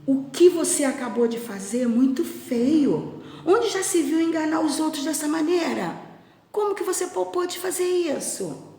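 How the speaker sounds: high-pitched storybook scolding; acted indignation. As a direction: tell the boy off for his trick. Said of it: "ficou muito bravo".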